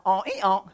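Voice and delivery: playful mock donkey hee-haw; donkey bray. Preacher answers his own question with a mock donkey bray. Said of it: "how's a donkey sound?".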